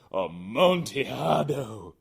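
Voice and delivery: slow, loud, drawn-out cry; Fortunato, obsessed. Acted. Fortunato obsessing over the Amontillado, slow and loud. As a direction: savor the word greedily.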